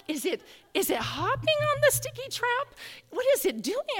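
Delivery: high-pitched puzzled questioning; baffled wondering. Retold or imitated. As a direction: wonder aloud way up high, mystified. Wondering aloud about the noise.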